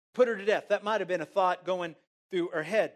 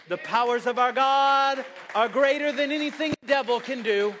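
raised, higher-pitched preacher's proclamation; triumphant pulpit cry; impassioned preaching; 4 semitones higher, 4 dB louder than usual. Real, not acted. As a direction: proclaim it at full force to the congregation. Repeats the line at full force.

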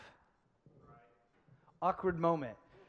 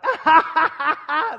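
loud, high mock child laughter; exaggerated high "ha ha ha" cackle; kid's cackle; 8 semitones higher, 11 dB louder than usual. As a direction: imitate a kid bursting out laughing too early. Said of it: "they're like".

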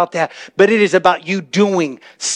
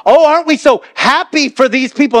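1 mock pastor prodding; 9 semitones higher, 4 dB louder than usual. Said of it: "It's like".

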